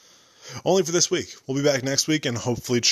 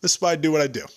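mock grand bow; 6 semitones higher, 4 dB louder, faster than usual. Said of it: "And I'll be like".

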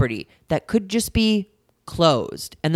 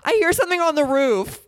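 frightened text; 10 semitones higher than usual. Retold or imitated. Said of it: "I text my birthday girlfriend".

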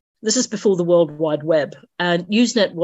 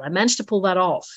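ordinary talk

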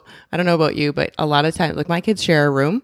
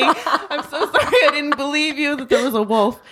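laughing apology; 12 semitones higher than usual. Retold or imitated. Apologising to daughter.

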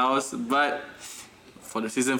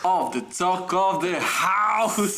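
slow, drawn-out show title; host intro; 7 semitones higher, much slower than usual. Acted. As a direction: announce the show name with flair.

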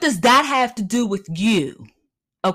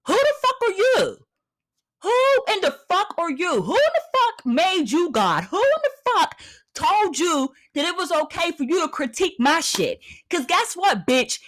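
heated rant; 6 semitones higher than usual. Real, not acted. Ranting at the critic.

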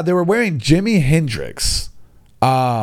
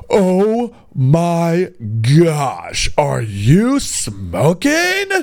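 mock shock; 4 semitones higher, much slower than usual. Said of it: "be like".

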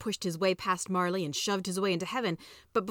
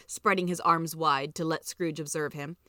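usual speaking voice

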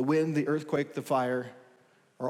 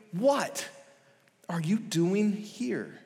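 slowed, searching question; 4 semitones higher, much slower than usual. Acted. God's question after the plea.

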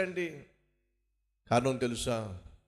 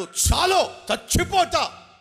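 high, loud desperate shout; preacher shouting Jonah's plea to die; 11 semitones higher, 9 dB louder than usual. Acted. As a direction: scream it like a man begging to die. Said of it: "యోనా అంటున్నాడు".

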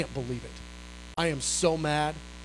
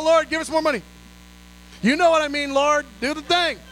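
higher and louder, begging Heaven; fervent loud plea to God; loud pleading prayer; 6 semitones higher, 5 dB louder than usual. Retold or imitated. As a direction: cry out to the Lord for more. Preacher pleading aloud on mic.

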